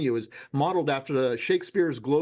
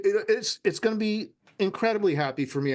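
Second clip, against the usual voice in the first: ordinary delivery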